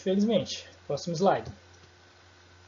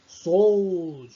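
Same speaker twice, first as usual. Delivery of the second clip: "sold" stretched long and slow; drawn-out sold; 7 dB louder than usual. Shown as a demonstration. Teacher stretches the word for the class, slowly. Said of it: "bem prolongado".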